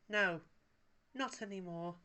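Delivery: flat. A narrator's reading. Paella flatly tells Wallace she no longer flies. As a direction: say it flatly, closing the subject. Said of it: "she said flatly".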